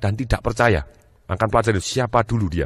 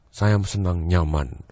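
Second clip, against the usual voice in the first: low, slow, softer voice with pauses; slow feeling-type speaker; 5 semitones lower, 4 dB quieter, slower than usual. Acted. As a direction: savour each phrase slowly and low. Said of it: "orang yang ngomongnya mantap". (lambat, rendah, penuh jeda)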